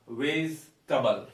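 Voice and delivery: slow 'Vegetable' as 'vez-tuh-bul'; correct pronunciation. Shown as a demonstration. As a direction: split 'Vegetable' slowly into 'vez' and 'tuh-bul'. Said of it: "It is actually vegetable".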